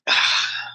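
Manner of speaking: breathy, choked sigh as tears come; a real sigh on the edge of crying; tearful sigh. Real, not acted. Widow overcome while recalling her dying husband, choked sigh. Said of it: "sorry, memories coming back".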